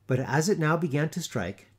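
plain reading voice

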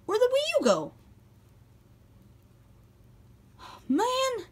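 very high, whiny outcry; dismayed. Acted. Mario finds his Wii U is missing, high dismayed cry. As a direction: wail it in squeaky disbelief.

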